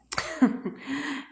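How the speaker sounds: quick soft chuckle at the memory; amused laugh. Real, not acted. Coach amused by what boyfriend once said, amused laugh.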